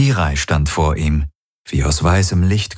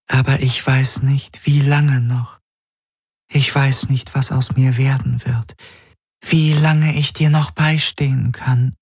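higher, grieving spirit voice; a spirit fearing she will fade; sad glass spirit; 7 semitones higher than usual. A narrator's reading.

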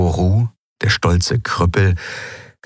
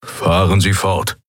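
growled. A narrator's reading. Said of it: "knurrte der Skrier".

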